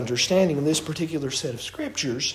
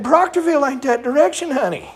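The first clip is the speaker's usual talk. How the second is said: higher-pitched, mock-indignant correction; insistent retort; 6 semitones higher than usual. Driver correcting the GPS on the way to Proctorville, insistent. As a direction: retell a firm objection to bad directions. Retells arguing with GPS directions.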